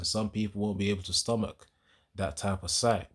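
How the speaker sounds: ordinary talk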